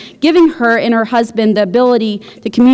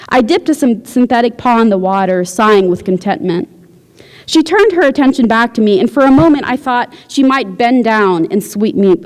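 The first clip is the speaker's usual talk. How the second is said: usual speaking voice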